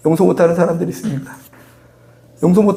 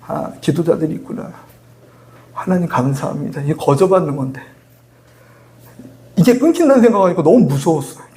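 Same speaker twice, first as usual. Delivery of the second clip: ordinary talk, nothing marked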